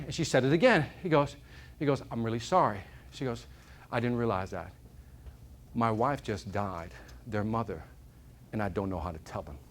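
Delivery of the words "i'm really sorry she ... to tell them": quiet grieving father. Retold or imitated. Grieving father apologizing to the lady on the train, soft and heavy. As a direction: let the grief weigh each word down.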